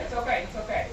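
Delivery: usual speaking voice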